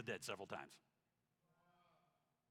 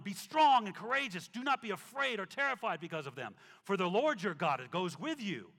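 preaching scripture; 4 semitones higher, 8 dB louder than usual. Acted. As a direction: proclaim each line with force. Reads scripture with force.

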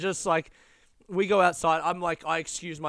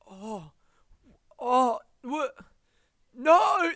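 mock devastated cry; 10 semitones higher, 9 dB louder, much slower than usual. Hearing the kitchen just closed, mock devastated wail. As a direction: wail "No!" as if the world ended. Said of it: "that level of devastated".

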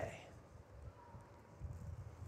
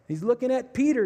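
ordinary talk

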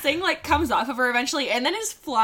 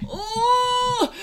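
high, drawn-out squeamish ooh; squealed shudder; 14 semitones higher than usual. Real, not acted. Moth-hater bracing for the story, squealed shudder. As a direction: let out a high shivery squeal.

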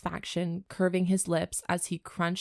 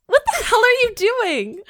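much higher, louder shrill squeal; 10 dB louder than usual. Shown as a demonstration. Redoing the line for her co-host as a squeal. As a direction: squeal it out in shock. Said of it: "Give me a squeal".

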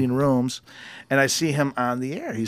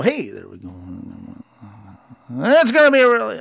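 mock radio voice; 5 semitones lower than usual. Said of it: "you cannot".

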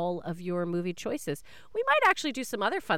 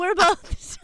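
laughing; 11 semitones higher, 9 dB louder than usual. Real, not acted. Laughing echo.

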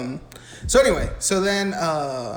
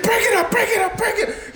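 high-pitched mock principal breaking up a fight; principal intervening. Principal breaking up a students' fight, hammed-up high voice.

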